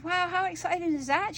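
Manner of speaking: high, mildly excited retold gush; impressed visitor. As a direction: imitate a visitor's wonder. Acted quote of impressed visitor.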